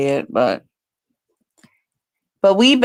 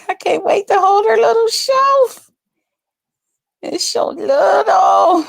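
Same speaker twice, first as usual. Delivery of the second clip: gushing. Real, not acted. Gushing about the coming baby.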